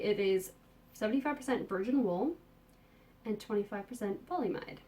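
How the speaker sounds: ordinary talk, nothing marked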